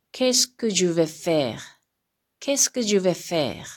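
"qu'est-ce que je vais faire?" read slowly, stressed on qu'est-ce; slow French model reading. Shown as a demonstration. Teacher reads the third question form for students. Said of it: "三つ目が".

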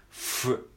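F as an unvoiced hiss of lip on teeth; teacher showing the F; English F. Shown as a demonstration. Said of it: "下唇を上の歯で押さえて".

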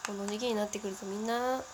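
normal talking voice

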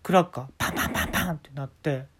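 ordinary talk, nothing marked

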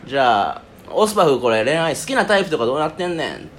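high talk-show host voice; Sanma. Pretend. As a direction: play a Kansai TV host. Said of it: "さんま御殿のさんまさんみたいな気分".

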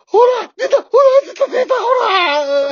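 high, loud panicked yelling; panicked shout. Acted. Husband seeing the attendant as a ghost, panicked shouting. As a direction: shout in terror that it came.

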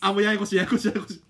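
loud laughing complaint; a laughing moan. Real, not acted. Laughing at confusing phone.

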